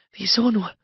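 high, mildly hissed question. A narrator's reading. Woman questioning her lover, hissing. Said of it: "zischte sie zwischen den Zähnen hervor".

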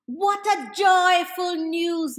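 normal talking voice

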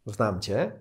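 ordinary delivery

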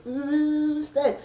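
high, loud disgusted ugh; grossed out. Retold or imitated. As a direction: recoil at the sight. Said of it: "I was like".

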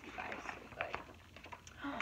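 breathy whispered aside. Real, not acted. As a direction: whisper it like a secret treat. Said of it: "chocolate is one of my favorite".

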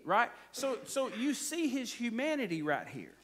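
ordinary talk, nothing marked

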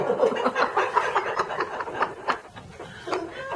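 long, high-pitched laugh. Real, not acted. Storyteller cracking up at her own cyclo tale, long high laugh. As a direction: burst into helpless, drawn-out laughter.

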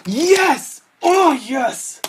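high-pitched burst of joy; real, jubilant high yell; elated cheer. Real, not acted. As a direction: cry out in triumph at a lucky drop. Celebrating a good drop.

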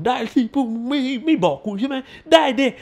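a hint of a defiant reporter; voicing Gale.

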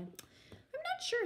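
very high, slow, small voice; vague little child. Acted. Stella asked about her shoes, high and vague. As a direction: answer slowly in a high child's voice.

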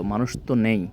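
ordinary delivery

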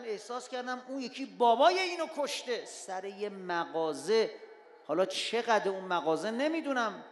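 loud, pleading sermon voice; impassioned preaching. Acted. Preacher exhorts the congregation about forgiveness, loud and impassioned. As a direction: press the moral on the listeners.

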